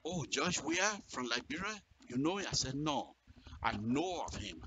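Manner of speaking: ordinary talk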